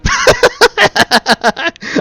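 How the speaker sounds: high, long derisive laugh; mocking cackle. Villain laughing at the knight's answer. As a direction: cackle at her despair.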